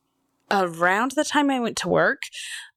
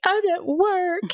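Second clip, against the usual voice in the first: high, strained reply; 12 semitones higher, slower than usual. Retold or imitated. Retelling her phone call, crying.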